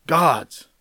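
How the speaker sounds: "God's" alone, high start and falling; stress on God's. Shown as a demonstration. Preacher naming the most important word, emphatic "God's". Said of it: "It's the most emphatic".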